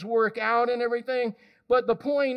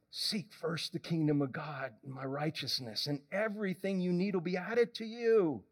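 much lower and softer, calm and firm; gentle voice of God; 8 semitones lower, 7 dB quieter than usual. Pretend. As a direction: soothe like a patient father. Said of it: "And God says".

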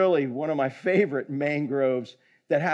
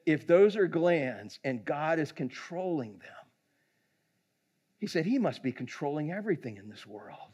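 retold quiet realisation; 3 semitones lower, 6 dB quieter than usual. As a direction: let the realisation land gently.

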